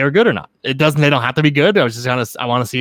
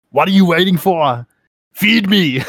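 put-on slow, higher, louder Austrian action-hero accent; Arnold Schwarzenegger impression; 6 semitones higher, 6 dB louder, slower than usual. Doing Arnold Schwarzenegger at a picnic in a hammed-up Austrian accent. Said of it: "I can't really do".

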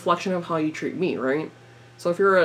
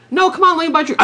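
pushy whiny guy; 10 semitones higher, 5 dB louder, much faster than usual. Retold or imitated. Guy pushing harder.

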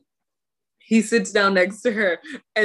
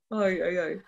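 ordinary delivery

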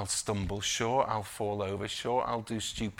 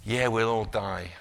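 ordinary talk

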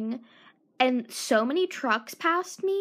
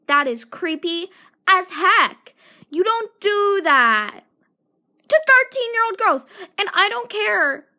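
outraged shout; 6 semitones higher, 8 dB louder than usual. Real, not acted. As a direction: hammer it in with fury. Outraged about a thirteen-year-old.

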